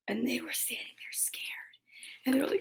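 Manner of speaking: hushed scared whisper. Acted. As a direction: whisper it like you're hiding. Scared pigs hiding.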